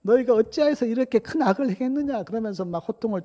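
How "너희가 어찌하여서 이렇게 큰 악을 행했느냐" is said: high-pitched mock reprimand; indignant retold scolding, high but only slightly louder; acted scolding. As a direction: rebuke them as the accusing lord, pitch raised. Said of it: "요셉이 또 막 호통을 치지요".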